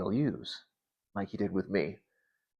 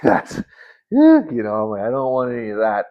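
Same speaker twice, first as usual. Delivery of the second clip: loud balking; 3 semitones higher, 10 dB louder than usual. Retold or imitated. Refusing to hug a stranger.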